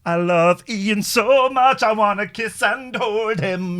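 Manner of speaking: high, slow, gushing swoon; loud sing-song mock infatuation; mock lovesick note-writer. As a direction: tease with a drawn-out crush voice. Mock reading of co-host's secret notes.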